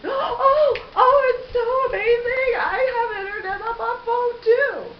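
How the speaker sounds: high, loud hammed-up gushing; mocking imitation of wild excitement; mock amazement. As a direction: squeal it like an overexcited fool. Mimics the guy gushing over internet.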